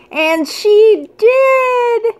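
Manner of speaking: thrilled, teary cry of delight; overjoyed. Real, not acted. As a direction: squeal it out, nearly in happy tears. Finds the hoped-for ornament.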